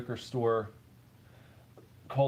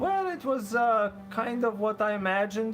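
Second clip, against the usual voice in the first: higher, guessed Argentinian accent; made-up Argentinian accent; 6 semitones higher than usual. Shown as a demonstration. Streamer guesses at an Argentinian accent for chat. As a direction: try on an Argentinian accent you've never heard. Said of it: "this would be sort of the way".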